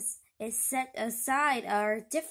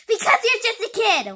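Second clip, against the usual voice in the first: loud, higher, with a laugh; laughing shout; 8 semitones higher, 12 dB louder, faster than usual. Acted. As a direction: blurt it out laughing. Villain loses patience, laughing.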